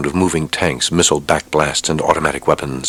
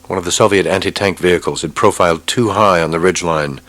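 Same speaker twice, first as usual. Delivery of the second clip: ordinary delivery, nothing marked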